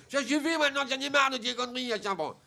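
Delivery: high, lisped furious shout; speech defect. Acted. Little boy cursing the prankster, lisped fury. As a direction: blow up while slurring s into sh. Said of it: "il parle comme ça".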